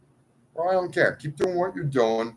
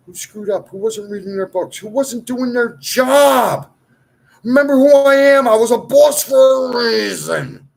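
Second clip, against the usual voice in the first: furious shout; 8 semitones higher, 11 dB louder than usual. Real, not acted. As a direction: bellow it out as an accusation. Ranting shout at accusers.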